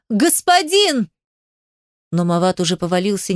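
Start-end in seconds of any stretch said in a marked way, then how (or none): 0.1-1.1 high, alarmed cry to a master; anguished yell. A narrator's reading. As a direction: cry «Господин» out, pitched far higher. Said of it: "Ты рванул к нему с воплем". (отчаянный вопль высоким голосом)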